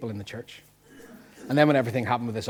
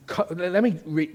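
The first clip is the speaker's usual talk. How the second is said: ordinary talk, nothing marked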